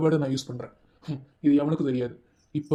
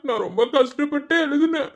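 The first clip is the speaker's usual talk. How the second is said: mock crying; 9 semitones higher than usual. Begging, crying sound heard.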